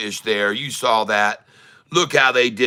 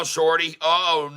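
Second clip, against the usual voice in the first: normal talking voice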